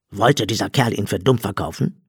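ordinary delivery

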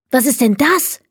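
a touch of startled excitement; excited. A narrator's reading. Leni finding something under the plaster, mildly excited. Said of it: "rief sie aufgeregt".

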